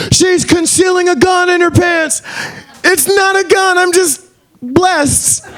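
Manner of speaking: hammed-up high shouted cop scene; cop scene voices. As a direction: shout every line like a frantic standoff.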